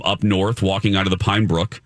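ordinary delivery

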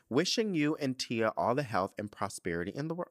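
usual speaking voice

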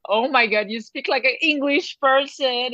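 playful retelling of a startled reaction; amused amazement. Retold or imitated. Friends teasing each other over switched accents, amused amazement. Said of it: "We're like".

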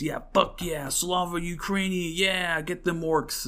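lightly voiced jeering fan reading comments; mock online cheerleader. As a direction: sneer as you voice the gloating commenters. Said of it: "you read these comments".

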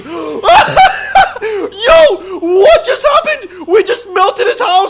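high, slow, laughing shriek; excited laughing scream. Real, not acted. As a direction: shriek with disbelieving joy. House melted, screaming in glee.

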